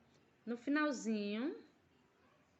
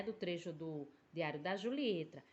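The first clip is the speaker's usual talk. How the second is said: ordinary talk, nothing marked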